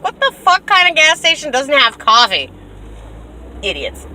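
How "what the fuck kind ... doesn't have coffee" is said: indignant outburst. Retold or imitated. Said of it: "I'm like".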